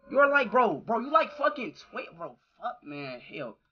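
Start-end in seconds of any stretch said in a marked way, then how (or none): none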